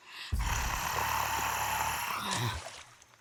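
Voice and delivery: long, loud breathy huff; dragon huff. Acted. Dragon fed up with a persistent pest, loud huffing sigh.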